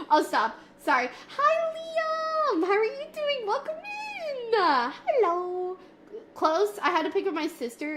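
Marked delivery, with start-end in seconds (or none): 1.4-5.8 high, slow, cutesy greeting voice; squeaky put-on greeting. Streamer greets a chatter in a high silly voice. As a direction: stretch each word in a high silly voice.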